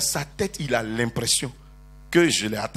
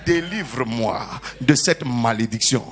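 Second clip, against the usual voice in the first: prayer for the congregation to repeat; 8 semitones lower than usual. Real, not acted. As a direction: lead the church in a spoken prayer. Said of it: "Dis avec moi".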